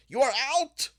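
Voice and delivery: high, loud bird call of out; referee shout. Acted. Bird referee calls player out.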